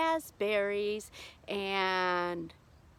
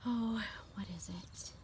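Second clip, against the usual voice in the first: whispered to herself; 5 dB quieter than usual. Real, not acted. Forgetting, talking to herself.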